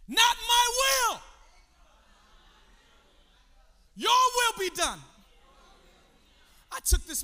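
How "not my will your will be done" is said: loud, high preaching cry. Real, not acted. Preacher gives the church words against selfishness, crying out.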